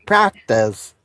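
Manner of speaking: laughing Proctor's in a Boston accent. Shown as a demonstration. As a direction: chuckle through a Boston accent. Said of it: "I can't do a Boston accent".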